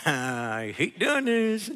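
drawn-out words through a pasted-on smile; badly faked happiness hiding reluctance; fake smiling. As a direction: fake laugh, then very slow forced cheer. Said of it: "That doesn't mean fake smiling".

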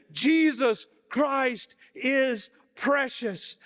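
high, loud, slow proclamation; old preacher proclaiming it again; ringing out. A narrator's reading. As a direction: let it ring to the rafters. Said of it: "his voice rang out so loud".

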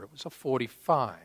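ordinary talk

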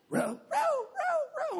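very high, slow bossy calls; bossy girl. Retold or imitated. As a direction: boss them around like a little girl. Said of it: "middle school girl bossing us around".

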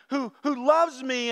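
ordinary talk, nothing marked

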